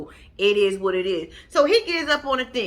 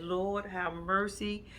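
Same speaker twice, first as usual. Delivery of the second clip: weary sigh; 4 semitones lower, 11 dB quieter, much slower than usual. Retold or imitated. Exasperated at Muhammad.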